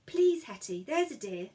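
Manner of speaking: ordinary delivery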